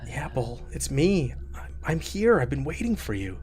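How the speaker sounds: soft, hushed ghost voice; ghostly whisper. Acted. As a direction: breathe it like a ghost from beyond. Ghost answers Bull softly.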